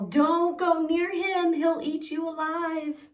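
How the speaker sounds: frightened crowd yelling out; townsfolk yelling. Acted. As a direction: shout a warning to the boy. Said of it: "People yell".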